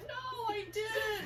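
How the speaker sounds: high, slow wailed denial; retold crying. Mom denying it to daughter, crying. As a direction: re-enact a tearful protest. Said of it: "I'm crying because she thinks".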